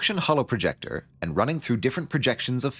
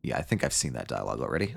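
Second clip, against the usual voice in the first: much lower and softer, fast; muttering to self; 11 semitones lower, 7 dB quieter, faster than usual. Real, not acted. Player realizing dialogue was seen, quiet fast mutter. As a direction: mumble it to yourself.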